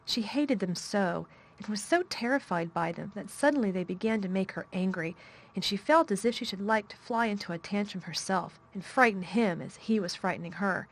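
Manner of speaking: ordinary delivery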